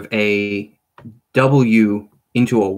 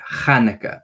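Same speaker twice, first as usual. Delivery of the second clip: normal talking voice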